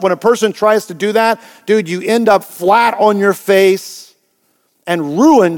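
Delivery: usual speaking voice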